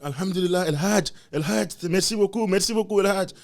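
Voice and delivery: shower the dad with praise, high-pitched; praising villagers. Retold or imitated. Villagers thanking the dad for the chicken, high praise.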